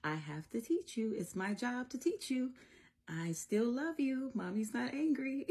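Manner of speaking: high-pitched reassuring mommy voice; mommy to child. Retold or imitated. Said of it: "this is me".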